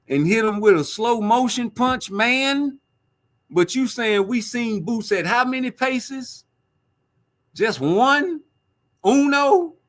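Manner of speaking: high incredulous scoff at the comment; mock disbelief. Real, not acted. Mocking disbelief, rising.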